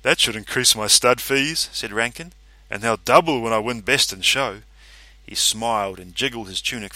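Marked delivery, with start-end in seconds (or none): 0.0-4.6 louder, self-satisfied boast; gloating. A narrator's reading. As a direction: gloat about the win. Rankin gloating.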